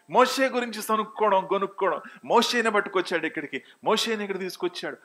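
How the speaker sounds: high, loud preacher's delivery; full-force preaching. Real, not acted. (గొంతు పెంచి ఉద్వేగంగా ప్రసంగం)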